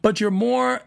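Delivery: ordinary talk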